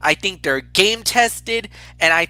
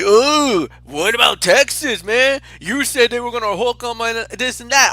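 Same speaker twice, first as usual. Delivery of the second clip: mocking friends' taunt; 3 semitones higher than usual. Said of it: "they're like".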